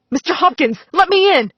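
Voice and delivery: loud, high call through a door. A narrator's reading. Missus Davis outside the door demanding entry, loud high call. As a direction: pound the door and call out to be let in.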